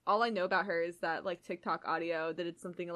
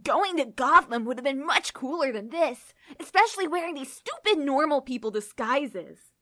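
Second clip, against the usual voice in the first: put-on louder, whining teenage boy; whiny teen boy; 6 semitones higher, 7 dB louder than usual. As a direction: whine it like a bored teen. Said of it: "He whined".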